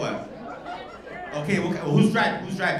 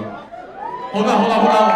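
loud, insistent hold on to the crowd; calling out to hold on; 3 semitones higher, 5 dB louder, slower than usual. Real, not acted. Comic shouting to the room to wait for the toast. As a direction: stop the whole room before the toast.

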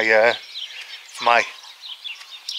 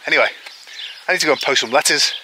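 ordinary talk, nothing marked

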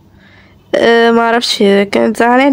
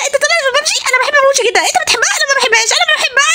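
put-on chatty child; 12 semitones higher, much faster than usual. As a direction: chat like an eager little kid. Child chatting about PUBG.

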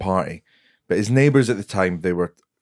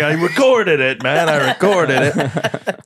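high, drawn-out excited impression; Tom Stade; 7 semitones higher, 4 dB louder, slower than usual. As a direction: stretch it out, high and loud, proud of yourself. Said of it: "you doing an impression of him".

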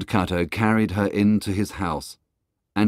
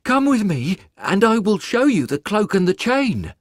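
higher, louder character voice for the woodcutter; 13 semitones higher, 5 dB louder than usual. Acted. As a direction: answer her firmly. Woodcutter invites the woman.

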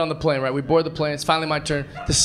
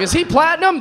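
much higher, louder goofy question; high silly joke; 10 semitones higher, 4 dB louder than usual. Retold or imitated. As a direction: squeak it out as a joke. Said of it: "I was like".

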